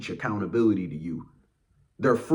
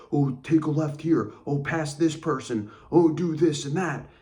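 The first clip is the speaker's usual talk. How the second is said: higher, louder mock nagging driver; backseat driver; 8 semitones higher, 4 dB louder than usual. Friend trying to control your driving, mock backseat-driver nagging. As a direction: imitate a pushy passenger giving orders. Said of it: "they're like a backseat driver".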